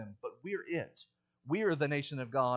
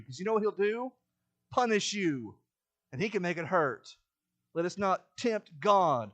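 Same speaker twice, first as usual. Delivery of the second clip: loud, higher-pitched sermon warning; forceful preaching; 5 semitones higher, 7 dB louder than usual. Real, not acted. Preacher warning the congregation not to dare God, forceful preaching. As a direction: preach it hard, punching the warnings.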